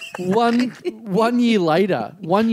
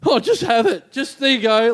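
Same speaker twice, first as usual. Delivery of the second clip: nervous, frightened; 8 semitones higher than usual. Retold or imitated. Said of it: "Like, I was frightened of them".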